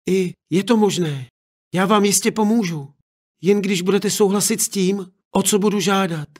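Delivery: put-on a girl promising her parents; confident daughter.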